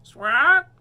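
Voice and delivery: high, loud parrot screech; parrot scream. Acted. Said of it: "screamed Polly".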